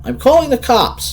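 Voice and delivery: high loud terrified scream. Acted. Sandra screaming at the creatures outside. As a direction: scream them away from the door. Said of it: "she screamed at them".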